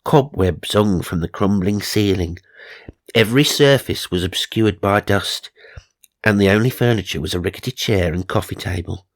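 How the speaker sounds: ordinary talk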